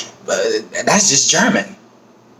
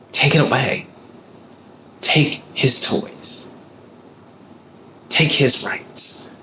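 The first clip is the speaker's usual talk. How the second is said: emotional anger; 3 semitones higher, slower than usual. Real, not acted. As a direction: drag out each demand, angry and emotional. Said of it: "I might be getting emotional. I'm just angry".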